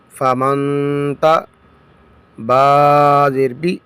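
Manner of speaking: slow, measured letter-by-letter recitation; slow spelling drill. Shown as a demonstration. Teacher drilling students in spelling, slow recitation. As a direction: chant each letter and vowel slowly.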